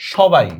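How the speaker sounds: সবাই opening on a loud "sh"; sh sound. Shown as a demonstration. Teacher shows spelling differs from sound, সবাই with "sh". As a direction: say সবাই as "shobai". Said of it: "উচ্চারণ করতেছি দেখো তালব্য স্বর মতো".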